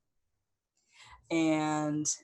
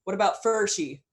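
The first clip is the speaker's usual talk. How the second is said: louder, higher call from the crowd; hunter's challenge; 5 semitones higher, 6 dB louder than usual. A narrator's reading. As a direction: throw a challenge at the leader. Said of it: "the hunter says".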